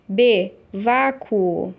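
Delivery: each syllable drawn out slowly, as if dictating; slow dictation. Shown as a demonstration. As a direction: read the word slowly for the class to note. Teacher reads exercise word aloud. (leitura lenta e pausada da palavra)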